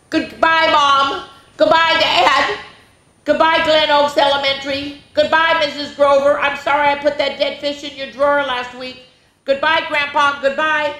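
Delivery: put-on a child sure he is doomed; melodramatic farewells. Boy says farewells.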